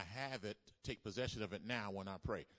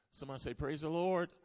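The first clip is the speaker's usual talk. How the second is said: loud, higher preacher's shout for response; preacher shouting to the congregation, higher and louder; call to congregation; 6 semitones higher, 9 dB louder than usual. Acted. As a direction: lift the room with it.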